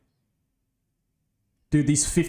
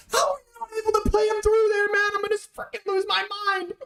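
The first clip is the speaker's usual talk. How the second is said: exasperated high rant; faster than usual. Real, not acted. Wasted three-on-two chance.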